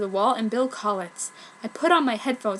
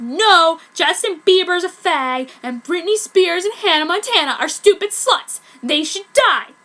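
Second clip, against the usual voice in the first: loud, higher disgusted rant; angry rant; 7 semitones higher, 7 dB louder than usual. Acted. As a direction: trash the pop stars. Goth ranting at prep.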